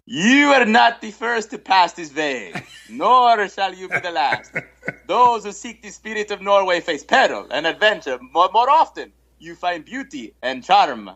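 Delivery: bad Norwegian accent. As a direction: play the Norwegian narrator in a mock Norwegian accent. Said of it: "here it goes with my best Norwegian accent".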